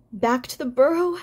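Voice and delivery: whimpering. A narrator's reading. Fiver dreads returning to the burrow, whimpering. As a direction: echo it back in a scared whimper. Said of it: "whimpered Fiver".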